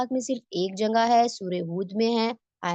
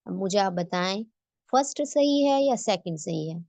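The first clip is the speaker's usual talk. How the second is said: ordinary talk, nothing marked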